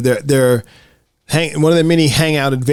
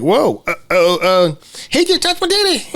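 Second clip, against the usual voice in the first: mock shocked woman; 7 semitones higher than usual. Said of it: "and she's like".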